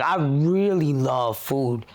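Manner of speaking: REALLY LOVE FOOD slow and drawn out; emphatic repeat. Real, not acted. Explaining to listener her attachment to food, slowly stressed "really love".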